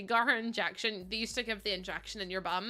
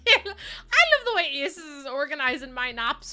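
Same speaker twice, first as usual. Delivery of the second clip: high, loud, laugh-filled voice; 7 semitones higher, 8 dB louder, slower than usual. Real, not acted. Streamer tickled at having naps organized, high laughing voice. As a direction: squeal it through real laughter. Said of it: "I know I'm laughing".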